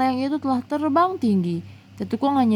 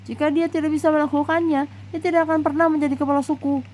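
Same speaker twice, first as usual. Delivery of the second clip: father's voice climbing higher and quicker; a father arguing, pitch rising as he insists; rising, hurried, insistent; 7 semitones higher, faster than usual. Acted. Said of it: "Intonasi suara ayahnya terdengar meninggi".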